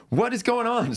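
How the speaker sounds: mock exasperated what-is-happening; squeaky bewildered complaint; confused user. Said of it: "it was like".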